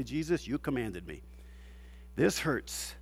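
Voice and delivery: soft, low prayer to Jesus; soft and low, pained prayer; quiet prayer. Retold or imitated. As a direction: murmur it softly as a prayer. Praying under his authority.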